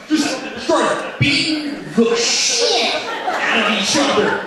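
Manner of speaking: usual speaking voice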